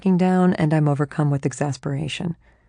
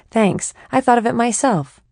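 higher, louder, pleased with itself; proud chatty mind; 6 semitones higher, 4 dB louder than usual. Acted. Her mind taking credit for the image, smug and chatty. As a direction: preen a little.

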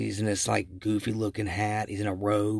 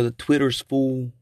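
lightly imitated movie catchphrase, louder; Cousin Eddie; 3 semitones higher, 6 dB louder than usual. Host repeating the parody sign's punchline, lightly voiced character. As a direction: quote the line in a slightly put-on, louder voice. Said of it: "Cousin Eddie says".

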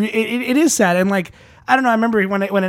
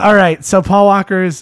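ordinary delivery

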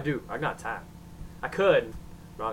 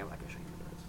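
whisper; 19 dB quieter, much faster than usual. Real, not acted. Takes back the joke.

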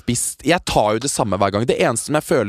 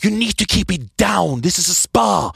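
higher, slow scolding English; stern spa employee; 7 semitones higher, slower than usual. Retold or imitated. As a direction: hush the noisy guests. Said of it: "bare sånn".

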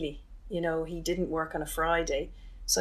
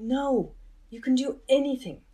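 loud, higher-pitched retold urging; emphatic insistence; 7 semitones higher, 5 dB louder than usual. Brother grabbing his sister by the shoulders, emphatic insistence. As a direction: drive it home as if grabbing someone by the shoulders. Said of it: "literally grabbing me by the shoulders".

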